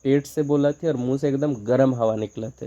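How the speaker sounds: ordinary delivery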